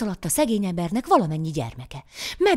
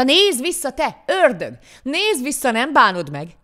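raised, loud taunting shout; calling after someone; 6 semitones higher, 5 dB louder, slower than usual. A narrator's reading. Poor man after the fleeing devil, shouting back his words. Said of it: "is eleget kiabálta".

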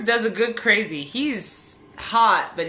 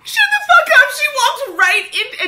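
high, loud delighted yell; excited disbelief; 14 semitones higher, 7 dB louder than usual. Real, not acted. Viewer yells at the show in delight, high and loud.

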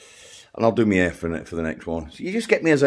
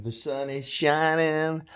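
drawn-out; 6 semitones higher, 5 dB quieter, slower than usual. Acted. Repeats the phrase, drawn out.

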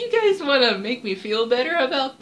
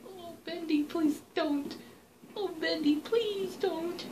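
soft frightened pleading; 11 dB quieter than usual. Real, not acted. Player hiding from Bendy, softly begging him not to come. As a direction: beg quietly so he won't notice.

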